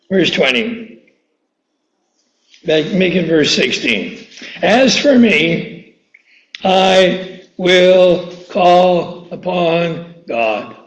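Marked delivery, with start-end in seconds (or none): 4.6-10.8 drawn-out, reverent scripture reading; slow solemn reading. Shown as a demonstration. Preacher reading a psalm to the congregation, slow and solemn. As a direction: read each word slowly and deliberately.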